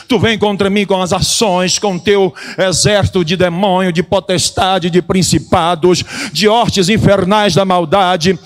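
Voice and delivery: loud declamatory sermon delivery; forceful preaching. Acted. Preacher defying the army of demons, forceful preaching.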